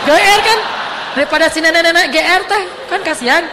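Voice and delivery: seruan tinggi dan keras; menggoda jenaka. Real, not acted. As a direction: lempar kelakar dengan suara melonjak. Teasing punchline to laughing audience. (kelakar heboh, nada melengking naik)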